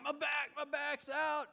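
high, drawn-out comic shout; exaggerated wail for help from the floor; mock pained cry. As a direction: holler it out in hammed-up agony. Acting out his cry from floor.

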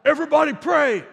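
high, loud shout to the room; yelled command. Retold or imitated. Son shouting to the family during the derby, high and loud. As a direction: yell it out like a kid at a big game. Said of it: "and he yells out".

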